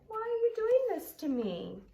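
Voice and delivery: high, pleading puppy voice; hurt little dog. Acted. Trevor begs Pig in a high, pleading voice. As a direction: plead like a wounded friend.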